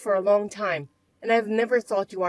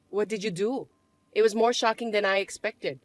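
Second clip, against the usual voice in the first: usual speaking voice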